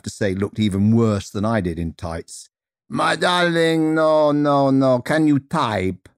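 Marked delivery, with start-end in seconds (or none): 2.9-6.1 high, slow, drawn-out Russian despair; Madame Fedra, rich Russian accent. Acted. Teacher dismissing her next victim, slow rich Russian accent. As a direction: wail it grandly with Russian vowels. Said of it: "speaking with rich Russian vowels".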